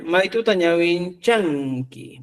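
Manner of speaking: slow, careful "Maitu tañawin chanki" with each word spaced; slow example sentence. Shown as a demonstration. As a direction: read "Maitu tañawin chanki" slowly like a teacher. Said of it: "por ejemplo".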